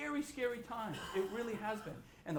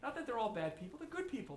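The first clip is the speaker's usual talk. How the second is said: ordinary talk, nothing marked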